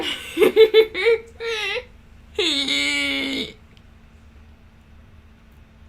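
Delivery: helpless squealing laugh; high, loud laughter. Real, not acted. Cracking up at own attempt at the voice, loud high laughter.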